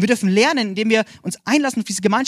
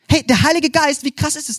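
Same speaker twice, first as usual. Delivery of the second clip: excited; 6 semitones higher, 3 dB louder than usual. Real, not acted. Excited outburst about Holy Spirit.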